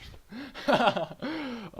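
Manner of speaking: loud, high burst of laughter; real laugh. Real, not acted. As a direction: crack up at your own joke. Laughs at own joke.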